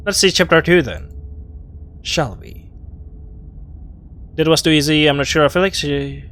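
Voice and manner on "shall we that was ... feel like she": ordinary delivery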